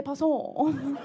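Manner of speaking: plummy pass on, drawn out; posh pass on. Retold or imitated. Mocking how the posh ex swore: slow plummy pass on. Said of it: "instead of saying piss off".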